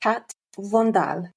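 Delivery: slow careful "Kat Vandal", "vahn-dahl"; "Vandal" copied slowly with a French open a; trying the French name. Shown as a demonstration. Host carefully repeats the guest's surname, trying French. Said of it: "Say it properly for me".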